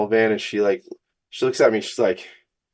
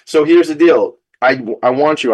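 higher and louder, all fired up; 6 semitones higher, 7 dB louder than usual. Real, not acted. Host fired up, rallying his listeners to act. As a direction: pump up the listeners. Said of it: "I'm just so fired up".

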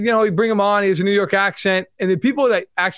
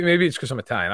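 ordinary delivery

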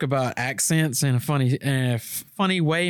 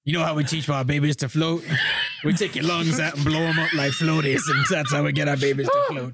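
mock Cajun swamp storyteller; bayou accent. Gator man explaining how babies float, bayou accent. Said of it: "Bayou boy".

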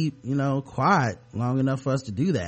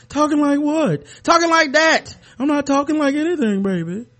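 much higher and louder cool funk-star voice; 14 semitones higher, 8 dB louder than usual. Pretend. Co-host tells him to stop, he denies in a slick put-on voice. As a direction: play innocent in a slick funky croon. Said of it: "Stop talking like that".

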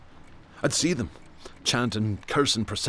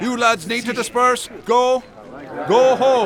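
higher, louder commanding shout; shouting order; 10 semitones higher, 6 dB louder than usual. Acted. Policeman orders the lads to go home, loud commanding shout. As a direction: order them off the street at full volume.

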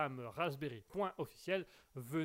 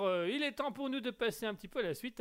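usual speaking voice

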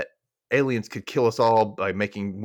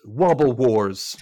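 slow announcement; 3 dB louder, much slower than usual. Retold or imitated. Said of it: "when he suddenly says".

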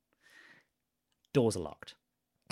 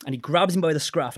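normal talking voice

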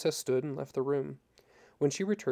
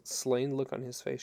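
usual speaking voice

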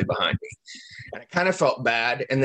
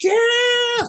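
high, loud whooping yeah; laughing yell; 4 dB louder than usual. Retold or imitated. Retelling a plane cheering, a high, loud laughing whoop.

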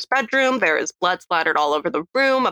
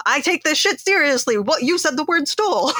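indignant detective; 8 semitones higher than usual. Retold or imitated. Host imitates the offended detective, pitched up. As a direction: huff it out, offended. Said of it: "he's like".

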